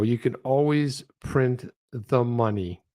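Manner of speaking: slow, deliberate repeat of the line; slowed, flat repeat of a line you can't believe; slow disbelief. Real, not acted. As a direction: let each word land, dumbfounded. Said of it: "I thought that was just incredible".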